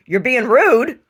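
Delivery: punchy, loud retold reprimand; retold rebuke. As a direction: deliver it as an emphatic scolding. Retelling her rebuke to the wife.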